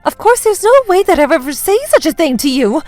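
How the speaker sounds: high-pitched protest of innocence; innocent act. Acted. Daughter-in-law denies the insult, put-on innocence. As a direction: deny it as if wrongly accused. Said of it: "continued with my innocent act".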